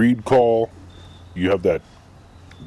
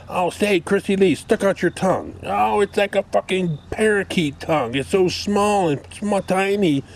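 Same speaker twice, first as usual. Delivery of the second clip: very high, simpering mock voice; squeaky mock cutesy talk; mocking parody; 12 semitones higher than usual. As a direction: ridicule her fishing for cute compliments. Mocking her on-air banter.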